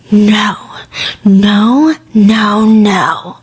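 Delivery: imitating a theatrical outburst; mock theatrical no. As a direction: ham up a dramatic refusal. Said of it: "it's like".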